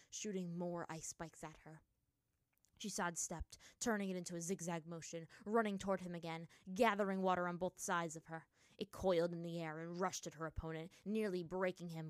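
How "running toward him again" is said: usual speaking voice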